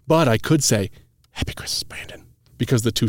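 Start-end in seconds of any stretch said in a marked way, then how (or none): 1.3-2.3 soft whispered wish; whispering. Shown as a demonstration. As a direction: whisper it softly. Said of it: "Can we stop whispering?".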